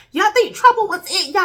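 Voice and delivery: light up as it comes back to you; excited. Real, not acted. Remembers the game excitedly.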